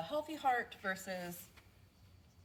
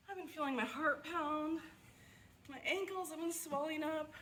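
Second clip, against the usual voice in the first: higher, anxious patient voice; worried patient; 9 semitones higher, 3 dB quieter than usual. Acted. Out-of-shape patient worrying about her heart, anxious higher voice. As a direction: play a fretful out-of-shape patient.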